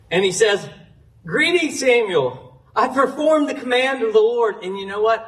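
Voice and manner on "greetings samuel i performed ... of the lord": high, sing-out hello as Saul; breezy, higher-pitched acted greeting; cheery greeting. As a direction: greet him as if all is well. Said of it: "like everything's great".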